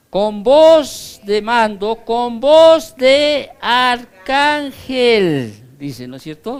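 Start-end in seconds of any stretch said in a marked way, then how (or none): 0.1-1.9 loud, slow ringing order; voice of command. Acted. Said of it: "¿quién repite la voz?". 2.0-5.5 voz de arcángel proclaimed loud, high and very slow; archangel's voice. Acted. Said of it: "Es la voz de Miguel".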